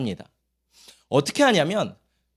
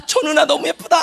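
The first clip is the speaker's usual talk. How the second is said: high-pitched, smitten exclamation; mock infatuation; 9 semitones higher than usual. Acting out Israelites seeing pretty foreign women, smitten gushing. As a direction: gush like a dazzled youth.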